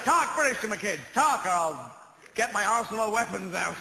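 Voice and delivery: loud, high American demand; imitating an American dad. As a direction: bark it like a pushy Southern dad. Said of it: "Then he said".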